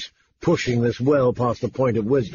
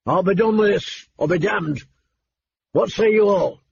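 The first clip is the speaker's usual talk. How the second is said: roaring tyrant king; 7 semitones higher than usual. A narrator's reading. King continues roaring at council.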